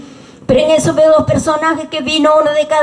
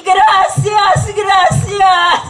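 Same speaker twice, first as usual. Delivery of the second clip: higher, drawn-out, emotional thanks; overcome thanksgiving; 4 semitones higher, slower than usual. Retold or imitated. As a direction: thank God with each word stretched. Retold overcome thanksgiving to God. (agradecimiento conmovido, con voz elevada)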